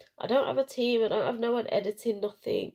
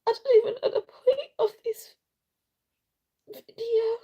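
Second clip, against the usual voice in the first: high, breaking voice through tears; real crying, words half whispered; choked-up, tearful whisper; 4 dB louder than usual. Real, not acted. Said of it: "yeah, I was crying".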